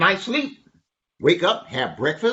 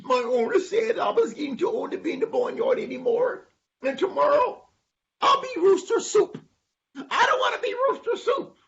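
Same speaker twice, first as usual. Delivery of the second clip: high squeaky rooster; rooster character. Acted. Rooster tells animals he faces the soup pot, high woeful voice. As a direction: pitch it way up as a forlorn little rooster.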